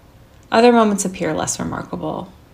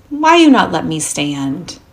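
put-on childlike curiosity; 8 dB louder, slower than usual. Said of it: "full of an almost childlike curiosity".

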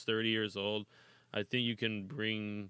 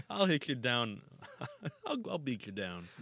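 laughing mock threat; 4 semitones higher than usual. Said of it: "I got something better for you".

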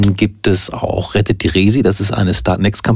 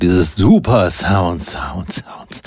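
slow echo fading to a whisper; satellite delay; 3 semitones lower, much slower than usual. Retold or imitated. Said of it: "Da hab ich einfach sofort im Kopf dieses Delay gehabt".

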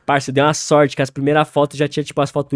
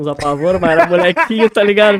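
laughing while talking; 2 semitones higher, 7 dB louder than usual. Real, not acted. Laughing while telling story.

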